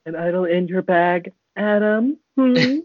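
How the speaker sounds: high, sing-song put-on voice; weird voice. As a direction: tease him in a strange high voice. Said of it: "Ben's, like, doing some weird voice".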